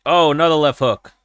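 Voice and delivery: high-pitched oh at another hook; excited reaction. Real, not acted. Viewer watching a fight calls out another left hook, excited. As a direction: call it out as if you can't believe it.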